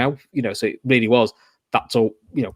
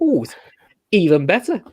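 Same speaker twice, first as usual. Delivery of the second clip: gleeful; 6 semitones higher than usual. Retold or imitated. Gleeful inner reaction retold.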